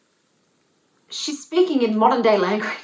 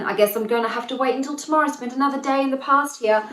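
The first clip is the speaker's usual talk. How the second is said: higher, quick parody of giving up; mock resignation; 6 semitones higher, 3 dB louder, faster than usual. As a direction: play a pushover putting it off, pitched up. Mocking heroine who keeps waiting.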